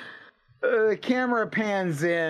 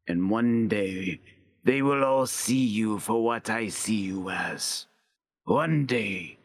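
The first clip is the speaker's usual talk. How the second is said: menacing bartender; 4 semitones lower than usual. Acted. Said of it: "starts chuckling loudly".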